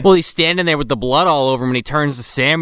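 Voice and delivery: normal talking voice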